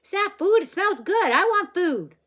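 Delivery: very high, loud eager dog; dog voice yapping for food, very high and loud; barking dog voice. A narrator's reading. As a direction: yap it out like an excited dog begging.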